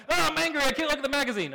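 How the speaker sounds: high, loud mock toddler tantrum; hammed-up angry small child. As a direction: wail it like an overwrought little kid. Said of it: "they're like, ... falling on the floor".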